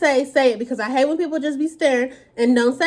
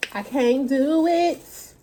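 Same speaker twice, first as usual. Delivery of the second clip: can't eat more; 2 semitones higher, much slower than usual. Real, not acted. Too full to eat more.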